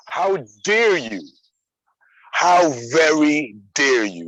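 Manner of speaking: high, slow, hammed-up indignation; mock outrage. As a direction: play scandalised, stretching every word.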